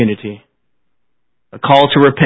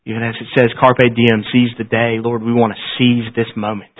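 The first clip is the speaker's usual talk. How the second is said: prayer. Acted. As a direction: pray humbly to close the service. Closing prayer after "Let's pray.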